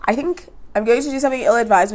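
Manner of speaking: ordinary talk, nothing marked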